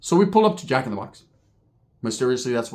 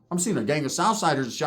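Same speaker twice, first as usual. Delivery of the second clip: ordinary delivery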